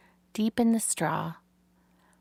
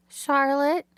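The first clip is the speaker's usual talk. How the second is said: higher, questioning piglet calling a name; hesitant young pig; 7 semitones higher, 4 dB louder than usual. A narrator's reading. Wilbur gently calls Charlotte before his big question. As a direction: ask shyly for her attention.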